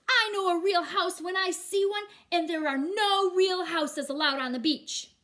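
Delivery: loud high lecturing judge; stern judge. Acted. Judge telling Matthew the rules, loud and stern. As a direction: lay down the law.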